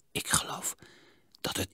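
fluisterend vermoeden; zacht gefluisterd. A narrator's reading. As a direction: fluister het behoedzaam. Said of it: "zei zacht". (zachte, geheimzinnige fluistering)

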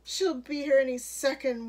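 anxious heads-up; urgent warning. A narrator's reading. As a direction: warn everyone, urgently. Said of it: "warned a third".

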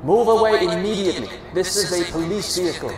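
high, loud command through a megaphone; loudspeaker shout. A narrator's reading. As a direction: bark orders over the loudspeaker. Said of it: "the officer shouts into the loudspeaker".

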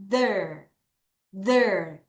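There with tongue between the teeth, soft th; th sound. Shown as a demonstration. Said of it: "혀를 넣었다 뺄게요". (혀를 넣었다 빼며 There 발음)

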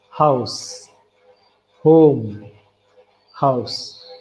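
"home" breathed in, "house" breathed out; word contrast. Shown as a demonstration. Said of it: "when we say the word home".

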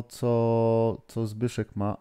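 ordinary delivery

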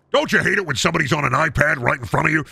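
normal talking voice

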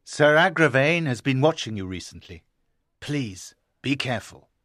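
low caution, softening into a plea; warning. Acted. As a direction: warn him, then plead low. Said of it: "Sir Bors warned".

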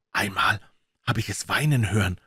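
ordinary delivery, nothing marked

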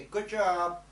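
loud, high, upbeat send-off; cheering sign-off. Real, not acted. Teacher praising students and saying goodbye, loud excited cheer. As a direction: call it out loud and high to the class.

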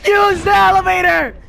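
loud high urgent call; urgent shout. Real, not acted. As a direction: yell the instruction. Urging teammate while fleeing.

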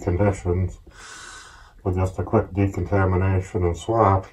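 muffled behind full face mask; speaking through respirator voicemitter; through a gas mask. Real, not acted. Seen 3: Gas mask with canister on face throughout; refs hold it unworn.

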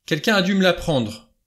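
high, vehement protest; vehement cry. A narrator's reading. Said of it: "s'écria Armstrong avec véhémence".

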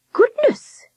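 put-on high, startled old lady's cry; surprised exclamation. A narrator's reading. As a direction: gasp it out in surprise. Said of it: "Miss Hilliard exclaimed".

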